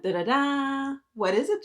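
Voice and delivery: ordinary delivery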